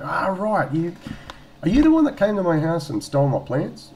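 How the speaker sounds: high, loud dawning realisation; sudden recognition. Retold or imitated. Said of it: "I went".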